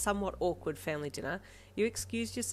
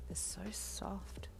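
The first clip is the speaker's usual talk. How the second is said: slow, tender whisper; loving whisper; 4 semitones higher, 8 dB quieter, much slower than usual. A narrator's reading. You toy with her earjack, loving whisper. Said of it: "you commented with love".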